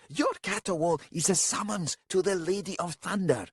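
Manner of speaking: high, strained angry warning; hissed rebuke. A narrator's reading. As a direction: scold the wailer in a pinched high voice. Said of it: "Ravast hissed".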